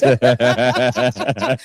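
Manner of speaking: knowing laugh; real laugh. Real, not acted. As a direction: laugh at the touchy topic. Laughs at the jollof question.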